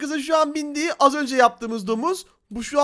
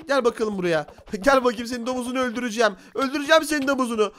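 normal talking voice

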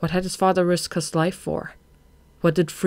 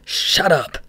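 loud, curt silencing; angry order; 5 dB louder than usual. A narrator's reading. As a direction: cut the underling off angrily. Boss cuts off the excuse.

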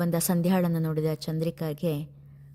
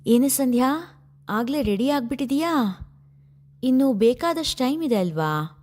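put-on higher, louder chirpy girl's call; playful friend's greeting; 6 semitones higher, 4 dB louder than usual. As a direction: tease a friend who dressed too early. Friend greets Sandhya teasingly. (ಎತ್ತರದ ಧ್ವನಿಯಲ್ಲಿ ಉತ್ಸಾಹದ ತುಂಟ ಕರೆ)

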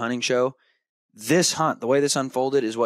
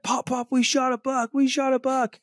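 put-on much higher, thrilled kid calling out over the phone; excited yelling. Boy yelling to grandpa on FaceTime, thrilled calling out. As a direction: yell the news like a kid bursting with it. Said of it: "Tucker's just yelling at my dad".

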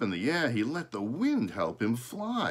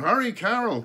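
high, loud urgent call; 13 semitones higher, 9 dB louder than usual. A narrator's reading. Father calls his daughter over the storm, high and loud. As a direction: call her over the wind. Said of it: "called Mister Gray".

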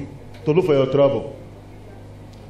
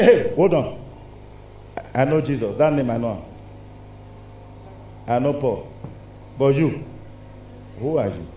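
lower, softer demon voice, sneering; mocking demon; 5 semitones lower, 6 dB quieter than usual. Demon challenging the sons of Sceva, low and scornful. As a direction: let the demon question them low and cold. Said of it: "he said".

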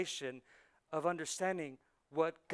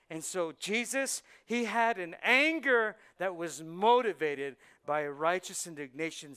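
louder, slowing as the point lands; preaching build; 3 semitones higher, 9 dB louder than usual. Acted. Pastor stressing Jesus' righteous anger, preaching louder. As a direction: lean into it and preach.

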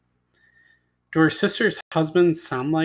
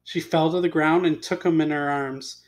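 ordinary delivery, nothing marked

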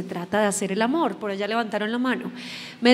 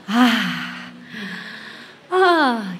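demo loud sigh. Shown as a demonstration. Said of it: "Y hagan un sonido fuerte".

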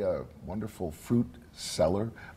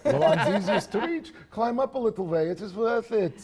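put-on higher, louder German accent; 11 semitones higher, 4 dB louder than usual. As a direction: speak as Herr Schultz in a German accent. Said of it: "give us a taste of your German accent".

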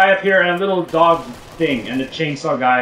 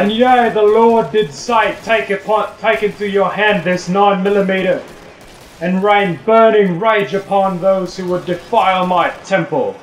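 preacher voice; 4 semitones higher, 4 dB louder than usual. Acted. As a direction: proclaim like an angry priest. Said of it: "I'm pretty sure God didn't say that".

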